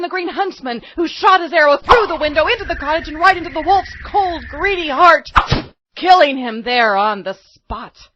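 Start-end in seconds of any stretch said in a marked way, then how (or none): none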